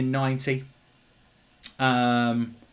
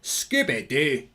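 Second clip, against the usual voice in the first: slow demo of a Scottish accent; put-on Scottish accent, drawn out; much slower than usual. Shown as a demonstration. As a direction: stretch it out like a Scot. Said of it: "how to do a Scottish accent".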